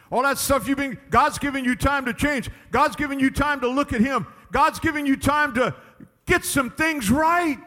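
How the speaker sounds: high, insistent pulpit refrain building to a stretched finish; full-force preaching. Real, not acted. Preacher urging people to use God's time, insistent preaching. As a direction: drum it into the congregation.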